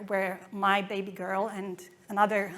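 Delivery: ordinary delivery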